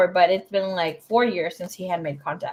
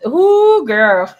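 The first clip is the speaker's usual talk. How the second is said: loud, high drawn-out whoop; high, gossipy outburst; shocked exclamation; 5 semitones higher, 10 dB louder than usual. Real, not acted. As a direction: react big to a juicy shock. Startled reaction to the card.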